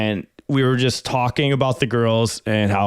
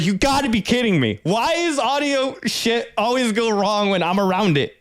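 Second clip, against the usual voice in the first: freaking out; 7 semitones higher than usual. Retold or imitated. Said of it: "I'm fucking freaking out".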